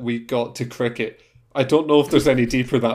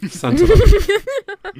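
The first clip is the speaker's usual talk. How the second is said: laughing; 6 dB louder, much slower than usual. Acted. Laughing echo of title.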